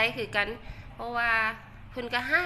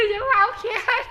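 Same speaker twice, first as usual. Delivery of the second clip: laughing; 11 semitones higher than usual. Real, not acted. Laughing while telling viewers to relax.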